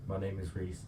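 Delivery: barely audible mumble; quiet. Real, not acted. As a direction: say it under your breath. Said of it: "Can you speak up".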